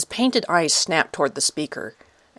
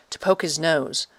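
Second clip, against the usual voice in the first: plain reading voice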